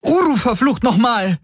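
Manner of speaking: very high exasperated cry; angry scream. A narrator's reading. Kirai curses at stubborn Uru, screaming. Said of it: "schrie sie".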